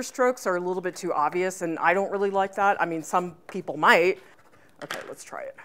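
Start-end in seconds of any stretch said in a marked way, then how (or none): 4.8-5.6 quick whispered aside; whispering. Real, not acted. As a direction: breathe it under your breath. Quiet aside before checking.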